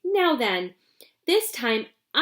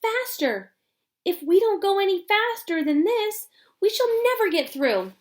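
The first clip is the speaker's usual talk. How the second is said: much higher, eager urging cry; high excited urging, a little louder; 10 semitones higher, 4 dB louder than usual. Acted. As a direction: egg the lift on, thrilled. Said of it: "cried Mister Wonka".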